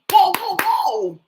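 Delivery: high, loud, drawn-out whoa; startled whoa. Real, not acted. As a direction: slowly cry out in surprise. Startled exclamation.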